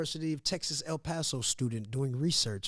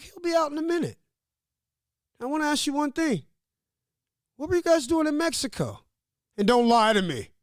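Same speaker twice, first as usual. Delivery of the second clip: mock officer, much higher and louder; questioning officer; 11 semitones higher, 7 dB louder than usual. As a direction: play the cop grilling him, pitched up. Interrogating officer voiced in story.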